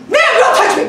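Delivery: high, loud yelp; alarmed cop yell. Retold or imitated. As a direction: back off in a panic. Cop recoiling from her.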